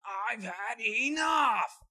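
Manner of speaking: slow, high, loud outburst; furious shout. Acted. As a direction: scream it out in rage. Same line shouted slowly.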